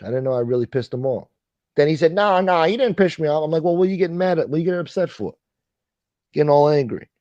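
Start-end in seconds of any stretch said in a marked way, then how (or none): 2.1-3.4 high-pitched imitation of him protesting; mock denial. Streamer imitating the man denying he's upset, high-pitched. 3.6-7.1 high-pitched, needling comeback; retold challenge. Streamer re-enacting his reply to the denial, needling and high.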